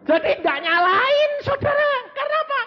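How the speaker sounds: high, loud preaching; excited. Real, not acted. Preacher rousing congregation, high excited call. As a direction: lift it up with energy.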